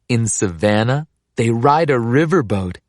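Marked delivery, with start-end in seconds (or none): none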